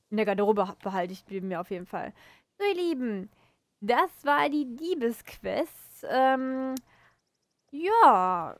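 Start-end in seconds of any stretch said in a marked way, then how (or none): 2.6-8.6 drawn-out outro. Real, not acted. Abschluss an die Zuschauer.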